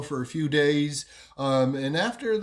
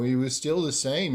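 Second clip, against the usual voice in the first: ordinary delivery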